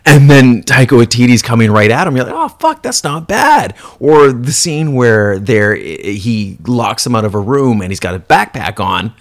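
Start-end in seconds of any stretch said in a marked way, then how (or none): 2.4-3.7 higher, mock impressed gasp; mock surprised viewer; impressed surprise. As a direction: caught off guard by the shot. Viewer reacting to clever shot.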